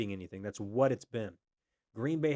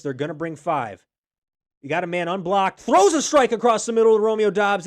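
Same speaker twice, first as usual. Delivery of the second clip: louder sports commentary, peaking on the throw; play-by-play; 4 semitones higher, 8 dB louder than usual. Acted. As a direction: call the drive live and building. Live play-by-play call.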